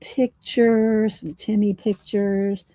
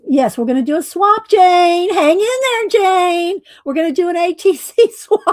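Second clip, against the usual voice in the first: yelling across the room; 11 semitones higher, 7 dB louder than usual. Real, not acted. Calling out to viewer Jane.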